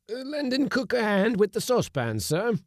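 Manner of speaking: high, loud character voice; old seaman. Acted. Bill explaining himself to the skipper, old seaman's voice.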